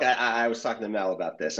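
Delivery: ordinary delivery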